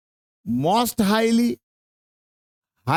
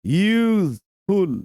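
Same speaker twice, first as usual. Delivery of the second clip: Useful stretched slowly into two parts, use-full; syllable-by-syllable; much slower than usual. Shown as a demonstration. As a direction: split useful into two long, separate syllables. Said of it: "दो syllable हो गया".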